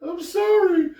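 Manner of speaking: high mock girlfriend sorry with a sigh; pleading apology.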